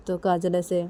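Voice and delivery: ordinary delivery, nothing marked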